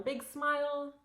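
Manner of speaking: bright cheerful teacher cue; coaching a child. Shown as a demonstration. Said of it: "So I'll tell them".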